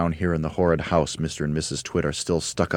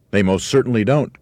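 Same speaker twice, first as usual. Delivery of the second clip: raised, loud denial; emphatic cry; 5 semitones higher, 7 dB louder than usual. A narrator's reading. Mugglewump answers the bird about cold weather, crying out. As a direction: cry out in firm agreement. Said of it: "cried Mugglewump".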